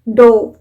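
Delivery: डोव punched out with a long o; loud, isolated डोव rhyming with drove. Shown as a demonstration. Teacher answers past form of dive, long-o डोव. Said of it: "dive का past form क्या हुआ".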